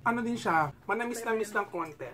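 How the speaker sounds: chewing while talking; speaking around food; mouth full. Real, not acted. Seen 1: Man bit the puto at -2, cheeks full while speaking.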